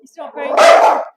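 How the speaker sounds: slow exasperated outburst; exasperated loud remark. Real, not acted. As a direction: shout it over the barking dog. Dog misbehaving on live stream.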